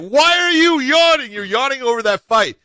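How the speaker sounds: high, loud playful indignation; teasing outrage. Real, not acted. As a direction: call him out, laughing at him. Teasing co-host over yawning.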